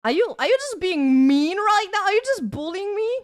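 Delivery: high, loud, offended questioning; wounded accusation. Real, not acted. Streamer accuses chat of bullying, mock-wounded. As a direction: accuse them in a high, hurt voice.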